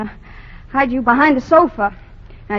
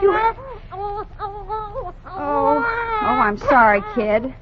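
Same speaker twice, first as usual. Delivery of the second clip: tearful; 8 semitones higher than usual. Acted. Breaks down over the baby.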